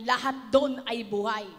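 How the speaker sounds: usual speaking voice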